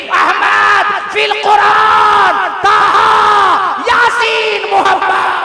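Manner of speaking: slow, soaring high chant of sacred names; full-force preaching. Acted. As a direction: hold each name out at full voice. Chanting the seven heavenly names.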